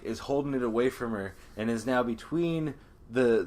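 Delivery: normal talking voice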